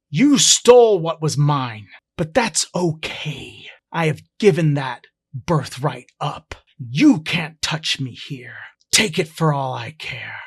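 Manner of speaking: loud, raging outburst; lashing out. Acted. Hawking lashes out at his uncle in rage. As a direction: let the rage boil over, loud and forceful. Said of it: "he lashes out".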